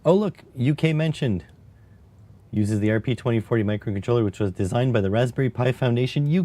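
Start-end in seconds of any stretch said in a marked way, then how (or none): 0.1-1.4 excited. Real, not acted. Spots UK in the docs.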